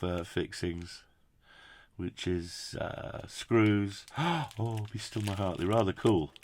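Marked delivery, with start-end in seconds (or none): none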